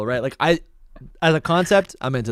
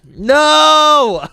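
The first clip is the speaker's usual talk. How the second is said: loud high protest; shouting; 12 semitones higher, 7 dB louder than usual. Real, not acted. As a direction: yell it out in protest. Protests being stuck with answer.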